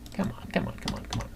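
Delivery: impatient whisper, urging the machine along; soft unvoiced pleading; whispered under breath. Real, not acted. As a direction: hiss it under your breath, willing it to load. Muttering at a stuck computer.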